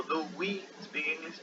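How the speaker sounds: slow, soft model question; model sentence. Shown as a demonstration. Teacher reads the example question to students, slowly and softly. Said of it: "por ejemplo".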